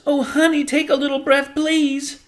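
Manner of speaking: high, loud anxious pleading; pleading boy. Acted. Boy Bryce begging his little sister to breathe, high pleading. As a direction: beg her gently to breathe, in a boy's voice.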